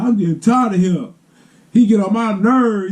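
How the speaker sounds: high-pitched whiny complaint, imitating little brother; mock tantrum. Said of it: "just throwing a fit".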